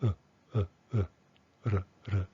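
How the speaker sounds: tiny, toneless "uh" and "r", each cut very short; reduced schwa. Shown as a demonstration. Teacher isolates the reduced sound for learners, "uh" as short schwa. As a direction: say "uh" and "r" as a bare neutral schwa. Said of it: "is gonna be reduced to schwon".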